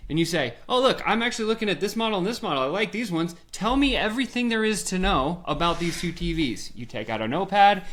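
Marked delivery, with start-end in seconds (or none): 0.7-6.7 higher, fast put-on keen buyer; feigned interest. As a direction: play the eager customer fishing for info. Customer playing interested buyer.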